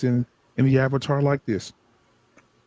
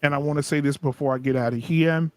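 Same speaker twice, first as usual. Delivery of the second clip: ordinary delivery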